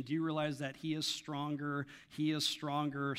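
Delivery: ordinary talk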